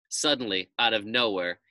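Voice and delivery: plain reading voice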